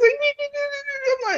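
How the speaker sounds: squeaky, very high pet-cooing imitation; baby talk to dogs. Guest mimicking tough woman talking to her dogs, squeaky. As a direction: coo way up high like fussing over dogs. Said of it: "How she talk to her dogs?".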